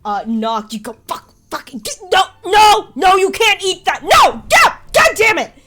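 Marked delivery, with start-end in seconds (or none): 1.1-5.5 panicked shouting at rats, much higher and louder; frantic yelling. Acted. Archivist yelling at mole rats behind the door, frantic shout. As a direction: yell in a flustered panic as things go wrong. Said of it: "you can hear the archivist kind of yelling".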